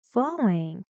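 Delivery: ordinary talk, nothing marked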